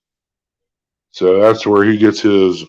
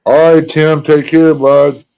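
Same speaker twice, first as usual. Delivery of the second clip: calling out; 6 semitones higher, 4 dB louder, slower than usual. Real, not acted. Calling goodbye to a viewer.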